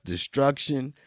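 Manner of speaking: ordinary talk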